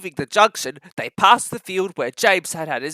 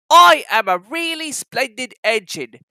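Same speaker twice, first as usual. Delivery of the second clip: proud James; 5 semitones higher, 6 dB louder than usual. Acted. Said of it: "he thought".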